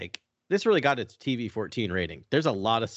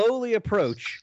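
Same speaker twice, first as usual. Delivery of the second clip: slowly, higher-pitched, stretching the words; drawn-out slowness; 12 semitones higher than usual. Shown as a demonstration. Podcast co-host disputing the word charge, drawn out slowly. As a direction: draw the words out to show how slow.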